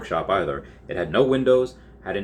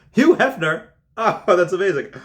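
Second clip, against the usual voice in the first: squealing high and loud; delighted shock; 10 semitones higher, 7 dB louder than usual. Real, not acted. Narrator shrieking with delight at the Hefner reveal.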